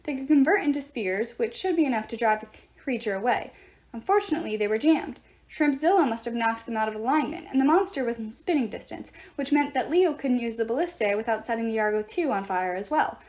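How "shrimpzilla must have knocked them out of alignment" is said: ordinary delivery, nothing marked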